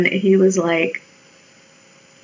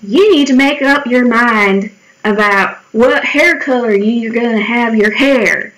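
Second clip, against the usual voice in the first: badly faked Georgia man's accent; shitty southern accent; 3 semitones higher than usual. Old man criticizing pastel hair at farmer's market, bad Southern accent. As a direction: put on a clumsy Southern accent. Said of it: "I'm giving him a shitty so-, southern accent".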